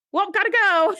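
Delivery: high, sing-out awkward goodbye; mock awkward exit. Sam retold, fleeing Riker after his failed line, awkwardly high.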